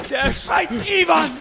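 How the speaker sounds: slow, bitter curse at the enemy; angry curse. Acted. Soldier cursing the enemy who jumps into his crater, angry.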